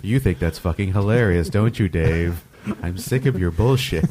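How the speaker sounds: low, fed-up machine voice; monotone computer. Acted. Computer sick of Dave's jokes, low monotone. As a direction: complain low like a tired computer. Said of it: "computer starts talking into a monotone".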